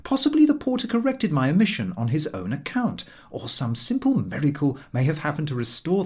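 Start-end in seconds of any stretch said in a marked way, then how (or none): none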